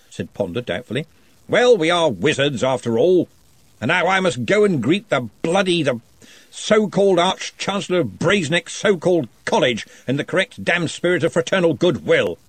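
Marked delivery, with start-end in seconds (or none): 1.5-12.4 louder, higher, hearty interrogation; booming wizard questioning. Acted. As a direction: press the shy goblin with jovial authority. Ridcully grumbles about rival.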